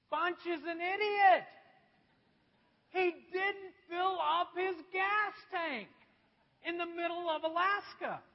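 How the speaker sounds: preacher shouting his mistake to the congregation; self-mocking shout. Real, not acted. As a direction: shout your own blunder at the crowd. Preacher mocking his own foolishness.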